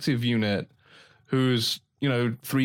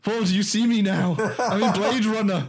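mock pleading wrestler; 6 semitones higher than usual. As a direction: beg Paul for attention, laughing. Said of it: "It's like".

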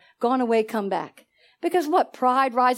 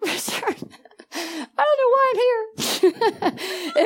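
high, slow, laughing through tears; tearful plea, half laughing at herself; retold crying; 8 semitones higher, slower than usual. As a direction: replay yourself breaking down in tears, half laughing. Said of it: "Richard, I was crying".

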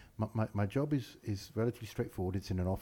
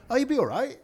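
high, loud, breezy dismissal; unsympathetic brush-off; 7 dB louder than usual. Retold or imitated. Mates coaxing the on-call doctor out, breezily unsympathetic. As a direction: wave away their worry in a raised voice. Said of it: "we were so unsympathetic".

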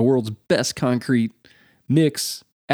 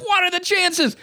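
much higher, incredulous cry; mock disbelief; slower than usual. He learns the dingo really ate the baby, incredulous. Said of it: "I'm like".

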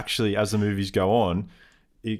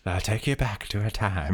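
storytelling voice; 4 dB quieter than usual. Pretend. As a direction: lead them back in time like a tale. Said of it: "that was my storytelling voice".

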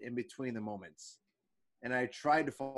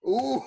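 frustrated exclamation; 13 semitones higher, 8 dB louder than usual. Real, not acted. Angry outburst.

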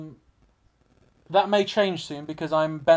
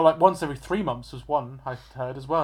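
usual speaking voice

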